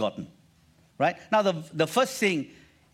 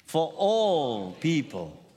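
1 slow drawn-out repetition; much slower than usual. Shown as a demonstration. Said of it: "Again, say that with me".

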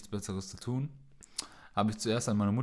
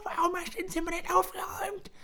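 scolding woman voice. Retold or imitated. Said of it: "so eine reumütige Frau".

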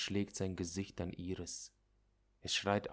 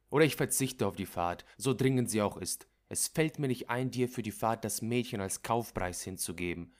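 erregte Forderung; 3 semitones higher, 6 dB louder than usual. Acted. Doctor insists angrily.

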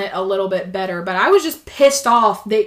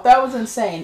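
ordinary talk

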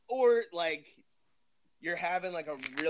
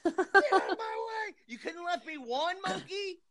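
very high, hammed-up wail; mock scream. Owner raging at the monkey over missing cigarettes, mock scream. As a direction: squeal it in comic outrage. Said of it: "hear me scream from the bedroom".

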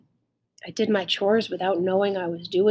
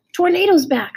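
high, loud, joyful news; excited announcement; 9 semitones higher, 7 dB louder than usual. A narrator's reading. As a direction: burst out with the good news. Child announces dog's return to father.

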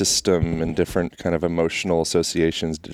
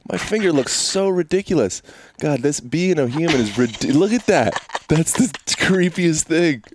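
mock disgust; 12 semitones higher than usual. Mock horror at his finger.

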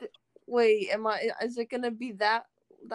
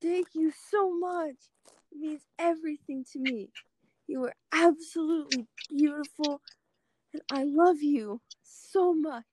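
higher, swooning confession; gushing; 5 semitones higher than usual. Acted. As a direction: gush out love. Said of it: "as much emotion I can put out".

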